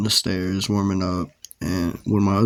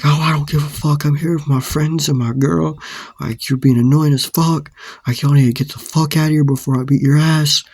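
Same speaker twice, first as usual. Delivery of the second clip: imitating an irate stranger, pitched up; retold higher-pitched rant; angry threat; 5 semitones higher than usual. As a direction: act out a hostile warning.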